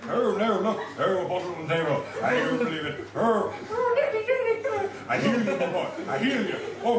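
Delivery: manic, loud comic impressionist; frantic, noisy comedy riffing; Robin Williams. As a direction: burst out in high, repeated "er" yelps. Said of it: "my impression of Robin Williams".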